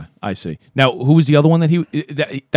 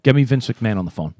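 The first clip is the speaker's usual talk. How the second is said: usual speaking voice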